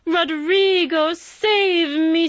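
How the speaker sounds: melodramatic scream, high and loud; stage heroine shrieking for Roderigo; frantic cry. Acted. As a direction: ham up a damsel crying for rescue. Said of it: "crying frantically".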